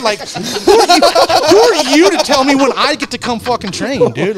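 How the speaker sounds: mock-furious, like yelling back at the team; retold angry outburst.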